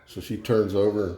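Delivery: ordinary delivery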